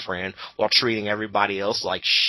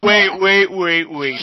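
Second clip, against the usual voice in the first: loud wait; 7 semitones higher, 8 dB louder, slower than usual. Real, not acted. Interrupting through laughter.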